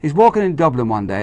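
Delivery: usual speaking voice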